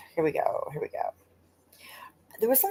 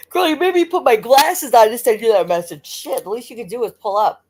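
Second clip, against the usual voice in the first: playful loud teasing; 4 semitones higher, 8 dB louder than usual. Real, not acted. Teasing a chat friend aloud.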